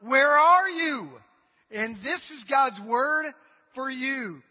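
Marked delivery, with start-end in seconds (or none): 0.0-1.2 loud, slow, high cry of where are you; crying out to God. Acted. A married person pleading with God for answers. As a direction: call up to God, drawn out and aching. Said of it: "trying to figure out".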